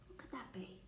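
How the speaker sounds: soft hushed whisper. Acted. Whispering the ladybird riddle question to children. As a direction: whisper it like a secret.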